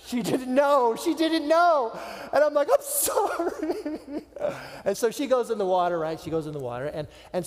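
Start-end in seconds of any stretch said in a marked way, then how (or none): 0.1-2.0 high, loud, mock distress; anguished exclamation. Husband excusing his unknowing wife, in mock anguish. Comic anguish at wife's remark. 2.7-4.2 very high, drawn-out squeaky whimper; mock tearful apology. Dad apologizing to his wife, mock sobbing.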